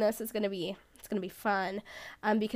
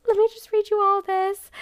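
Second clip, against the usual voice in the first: high, loud retelling of thrilled news; re-enacted breathless announcement, high and loud; overwhelmed excitement; 9 semitones higher, 8 dB louder than usual. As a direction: call out to a friend, voice lifted high. Retold thrilled news to friend.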